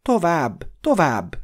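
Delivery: high, loud urging; shout. A narrator's reading. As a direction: drive the rider onward. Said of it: "kiáltotta Glorfindel". (sürgető kiáltás)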